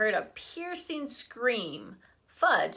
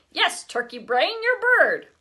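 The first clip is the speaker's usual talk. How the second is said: exasperated; 7 semitones higher, 6 dB louder than usual. A narrator's reading. Exasperated reply to brother.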